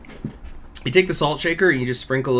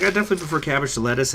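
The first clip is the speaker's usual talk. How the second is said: normal talking voice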